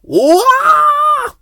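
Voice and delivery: very high loud falling cry; startled yell. A narrator's reading. Ben tumbling into the drain, high startled yell. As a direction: yelp as you topple in.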